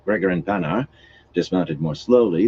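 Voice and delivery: ordinary delivery, nothing marked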